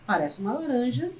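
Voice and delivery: ordinary talk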